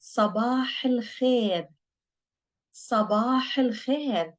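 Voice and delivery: slow "sabah al khair" with emphatic s; slow pronunciation model. Shown as a demonstration. Teacher models good morning in Arabic, slow pronunciation. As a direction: draw out "sabah al khair" for learners to copy. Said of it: "now we're gonna say sabah".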